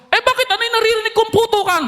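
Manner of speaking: high, anxious mother's voice on the phone; worried mother. Retold or imitated. Mother asking her soldier son why he's panting, high and worried. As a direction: sound like a mom fretting over her son.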